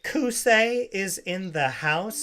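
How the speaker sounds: slow, puzzled "Kusei is in the house?"; mock misreading. Mocking the girl's misread sign, slow and puzzled. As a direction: drag "Kusei" out as a strange word. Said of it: "repeating the girl's reading".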